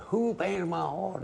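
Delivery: high, drawn-out holler across the dance hall. Retold or imitated. Angry dancer hollers over band, high holler. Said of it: "and he hollered".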